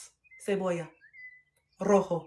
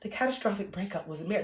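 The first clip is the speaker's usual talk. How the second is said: restrained, softer formal narrator delivery; mock professional audiobook read; 7 dB quieter than usual. Shown as a demonstration. Author showing how she won't read her audiobook, stiffly professional. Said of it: "I'm not gonna do the audiobook like this".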